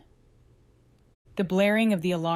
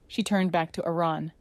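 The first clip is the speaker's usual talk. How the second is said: ordinary delivery, nothing marked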